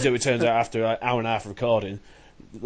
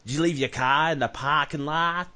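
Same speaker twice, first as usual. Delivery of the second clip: slow, higher-pitched American accent demo; 4 semitones higher, slower than usual. Shown as a demonstration. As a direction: draw out a put-on American accent. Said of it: "I can do a half decent American one".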